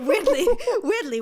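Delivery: high, loud, giggling through the words; laughing. Real, not acted. To her cohost about Danish accents, laughing. Laughing at own odd turn-on.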